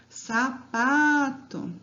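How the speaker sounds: "Sapato" very slowly, PA stretched long; long drawn-out PA in "Sapato"; calling the word out. Shown as a demonstration. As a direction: call "Sapato" as if summoning it, leaning on PA. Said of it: "vocês podem chamar a palavra".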